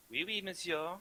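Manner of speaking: put-on French maid, soft and high; French maid accent. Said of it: "all done in a French accent".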